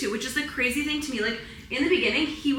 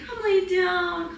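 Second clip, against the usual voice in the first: higher, slow coo to the dog; 8 semitones higher, slower than usual. Real, not acted. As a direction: coax the dog gently down, drawn out. Coaxing the dog to lie down.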